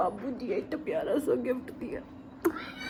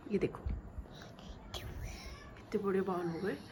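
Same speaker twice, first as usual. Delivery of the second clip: whisper; 4 semitones lower, 12 dB quieter than usual. Real, not acted. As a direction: hush it to the camera. Showing baby's hair softly.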